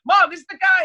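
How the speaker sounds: high, loud panicked call; yelling to mom. Retold or imitated. Son shouting to his mom as cop grabs him, high loud panic. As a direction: shout for mom, scared and urgent.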